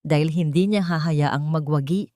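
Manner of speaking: usual speaking voice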